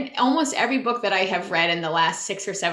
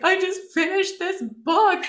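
mock sobbing; 10 semitones higher than usual. Sobbing after finishing the book.

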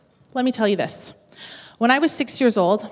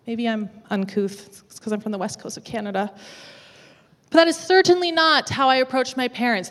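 ordinary talk

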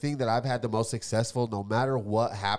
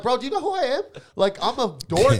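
indignant; 11 semitones higher, 4 dB louder than usual. Retold or imitated. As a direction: act out the outrage. Own retold indignant reply.

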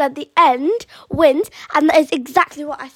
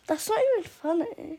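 laughing; 3 semitones higher, 7 dB quieter than usual. Real, not acted. Daughter laughing while denying joke.

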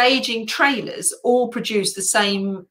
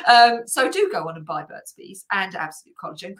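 ordinary talk

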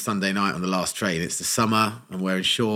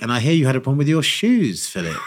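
presenter voice; 6 semitones higher, 6 dB louder than usual. Retold or imitated. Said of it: "the last thing she says is like".

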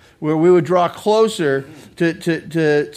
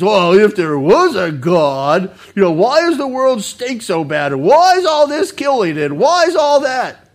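mock indignant doubter; 3 semitones higher, 6 dB louder than usual. Doubter demanding why God allows a bad world, mock indignant.

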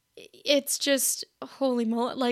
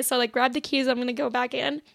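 normal talking voice

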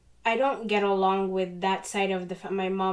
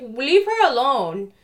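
louder, drawn-out protective command; firm mock shout, stretched out; retold shout; 3 semitones higher, 6 dB louder than usual. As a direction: imitate shouting people off. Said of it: "she shouted at them".

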